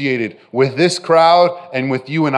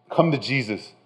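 soft invitation; 3 semitones lower, 8 dB quieter than usual. Acted. Preacher's soft invitation, repeated.

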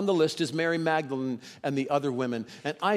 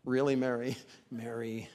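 gently chiding Mary; 4 semitones lower, 5 dB quieter than usual. Shown as a demonstration. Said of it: "You know, like".

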